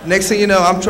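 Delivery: normal talking voice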